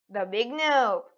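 ordinary talk

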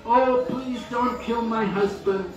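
retold wife begging, pitch lifted; imitating wife's plea. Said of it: "came and pleaded".

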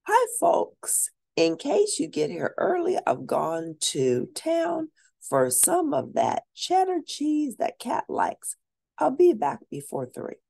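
a hint of a friendly aunt's note; reading Aunt Helen's note. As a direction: read the note like a cheerful aunt. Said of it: "there was a note on the door that said".

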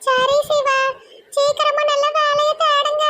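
put-on high, slow girlish voice; encouraging young woman. [name] urging Siva to find work soon, high-voiced encouragement. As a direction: send him off slowly in a high voice.

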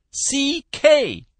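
high, loud letters; exasperated spelling. A narrator's reading. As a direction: call out the last letters impatiently. Caller finishes spelling in frustration.

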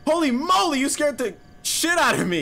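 startled scream into shaky laughter; scream into giggles. Real, not acted. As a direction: jolt with fright, then laugh it off. Jump scare, then scream.

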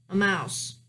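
ordinary delivery, nothing marked

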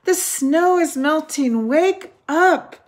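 high, loud and eager; excited crying out. A narrator's reading. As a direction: coax him out with big excitement. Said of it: "cried Frog".

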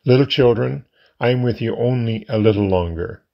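ordinary talk

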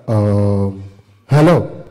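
lightly voiced rich caller, slow lead-in. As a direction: answer the phone as a measured big man. Rich caller answering the comedian's phone.

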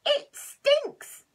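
high, loud cry of disgust. Acted. Goose complaining about the smell, disgusted loud squeal. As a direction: squeal it out, revolted by the stink.

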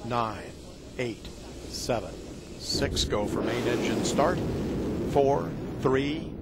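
rising to a loud liftoff shout; launch countdown call. Real, not acted.

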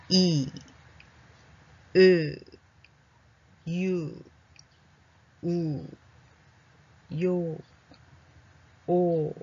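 vowels one by one with long gaps; unhurried vowel drill, well spaced. Shown as a demonstration. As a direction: read each vowel separately, leaving time between. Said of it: "もうちょっと早く読んでみましょうか".

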